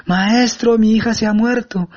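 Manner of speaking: retelling the wail, a slow, pleading cry; desperate wailing shout. Retold or imitated. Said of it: "venía gritando y lloraba y vociferaba".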